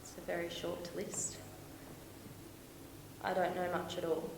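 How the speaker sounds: very soft, low admission; subdued, deflated hush; quietly defeated. Acted. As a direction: confess it almost to yourself. Bleakly lists what she knows.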